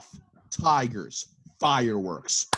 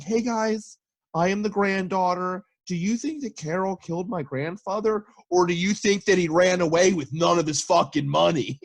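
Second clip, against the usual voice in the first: higher, chirpy mock granddaughter voice; lightly voiced granddaughter, higher; 8 semitones higher than usual. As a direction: play her bright and fake-sweet. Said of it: "she's like".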